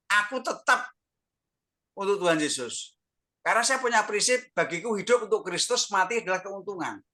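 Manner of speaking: high, emphatic declaration of faith; resolute testimony. Real, not acted. Sick man declaring his life is for Christ, resolute testimony. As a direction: proclaim it with a lifted, insistent voice.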